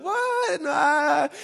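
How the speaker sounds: mock astonished squeal; shocked surprise. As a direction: leap way up in pitch in disbelief. Disciples start getting excited.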